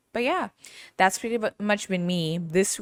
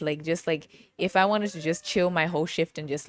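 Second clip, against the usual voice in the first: ordinary talk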